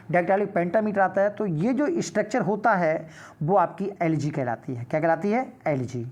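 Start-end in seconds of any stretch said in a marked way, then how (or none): none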